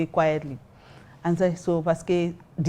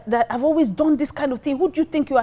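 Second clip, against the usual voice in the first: high-pitched mock accusation, not really shouted; retold angry rant; 7 semitones higher than usual. Drunk guy accusing her, imitated angry rant. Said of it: "he was just shouting and all that".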